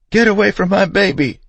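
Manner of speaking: high, loud, terrified shriek; mother with a gun shrieking at a stranger. A narrator's reading. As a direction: scream to protect the child. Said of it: "shrieking it at the top of her lungs".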